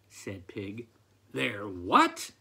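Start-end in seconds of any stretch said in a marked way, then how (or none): 1.3-2.3 sharp rise on a louder "what"; disbelieving, punch the final word upward; incredulous cry. A narrator's reading. Farmer answering the pig's news, incredulous cry. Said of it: "cried Farmer McPhee".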